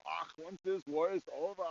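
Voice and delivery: lightly put-on German accent, cheerful and inviting; a friendly German soldier, mildly hammed. German soldier inviting the enemy for beers, light German accent. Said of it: "there's this German guy".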